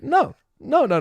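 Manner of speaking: high, loud no no no; excited denial; emphatic no. Real, not acted. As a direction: shut the idea down with high emphatic nos.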